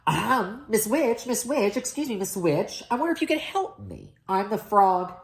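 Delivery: high, eager prince voice; polite young prince. A narrator's reading. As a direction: politely call out to the witch.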